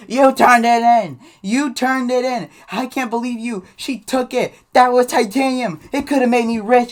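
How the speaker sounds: loud, high-pitched wailing imitation of a kid's fit; mock tearful tantrum. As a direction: wail it as an outraged, bawling schoolboy. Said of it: "he's crying his eyes out".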